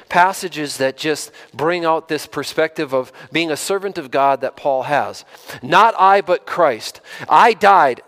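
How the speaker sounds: impassioned pulpit delivery; full-force preaching. Acted. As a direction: preach it with rising fervour to the congregation. Preacher quotes Paul with fervour.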